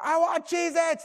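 put-on high, slow-stretched child's demand; imitated tantrum. Son demanding snacks from dad, mock high tantrum. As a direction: wail it like a hungry five-year-old. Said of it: "My five-year-old is screaming".

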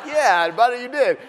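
high, loud congratulation; proud cheer. Retold or imitated. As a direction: cheer him on like a beaming dad. Proud dad cheers son.

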